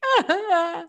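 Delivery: mock wail. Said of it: "And they're like".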